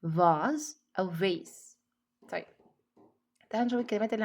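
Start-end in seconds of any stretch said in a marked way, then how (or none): 0.0-1.8 first "vase" as vahz, second "vase" as vayss; "vase" both ways, ah-z then ay-s; two pronunciations. Shown as a demonstration. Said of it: "الاتنين صح".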